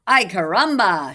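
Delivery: loud excited exclamation; cried out. Acted. Chicken crying out at the perfect nest, loud and excited. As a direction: belt out the chicken's cry. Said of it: "she cried".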